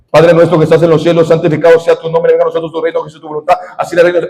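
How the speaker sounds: rattled off fast on one level note; rote recited prayer. Retold or imitated. Mimicking someone repeating the Lord's Prayer, rote rapid monotone. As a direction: rush through it mechanically, like a memorised repetition. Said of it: "y vuelve y lo repite".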